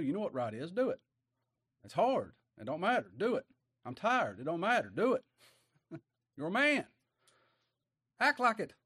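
preaching at full force. Acted. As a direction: build it up, pounding each do it. Preaching builds to exhortation.

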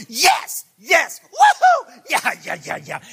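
loud, high whoops of joy; exuberant cheering. Pretend. Paul in his jail cell celebrating, shouting and whooping. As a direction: cheer like a fan in the stands.

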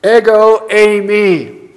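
"ego eimi" drawn out slowly, both words stressed; emphatic Greek. Retold or imitated. Preacher showing the Greek text's emphasis, "ego eimi" slow and emphatic. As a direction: give "ego eimi" slow, deliberate weight. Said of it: "he actually emphasizes".

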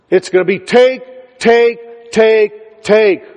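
building pulpit emphasis; preaching. Acted. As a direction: pound the word home. Hammers the repeated word.

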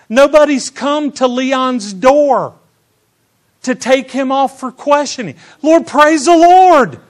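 loud, high, fervent preaching; preaching at full force. Acted. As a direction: shout the praise to the congregation.

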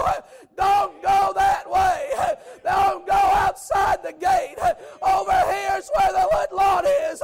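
loud, high, slow desperate pleading; pleading mother ewe. Retold or imitated. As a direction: beg the lamb to come home. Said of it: "she was going".

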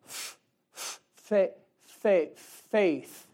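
halting, stammered reading, breaking on "f-" and "fa-" before landing on "faith"; child sounding out a word. Acted. Believer playing a child learning to read before the Lord, haltingly. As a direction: stumble through it like a beginner reader. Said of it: "And you go".

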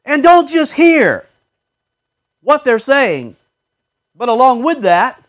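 loud, driving preacher's rebuke; fiery sermon delivery; full-force preaching. Acted. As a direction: hammer it home from the pulpit.

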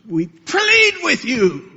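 high, drawn-out pleading cry; impassioned plea. Acted. Preacher voicing Paul's plea to sinners, impassioned pleading. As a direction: plead aloud, stretching the words. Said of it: "You don't find Paul speaking in those terms".

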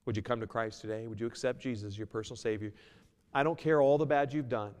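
ordinary talk, nothing marked

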